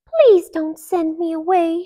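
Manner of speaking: high, loud pleading. A narrator's reading. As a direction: beg them. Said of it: "she pleaded".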